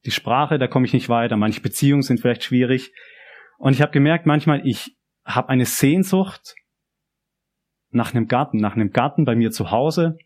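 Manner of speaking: ordinary talk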